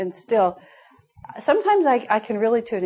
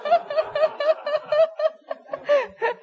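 real laugh, pitched up; high burst of laughter. Real, not acted. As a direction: laugh openly at the story. Laughs at the anecdote.

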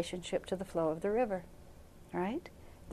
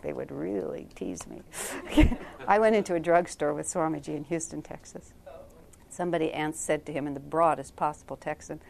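ordinary talk

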